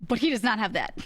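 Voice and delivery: normal talking voice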